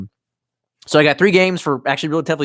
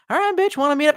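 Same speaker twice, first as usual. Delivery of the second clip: high-pitched retold proposition; eager mock come-on; 13 semitones higher, faster than usual. Acting out a reply to a flirty text, high mock eagerness.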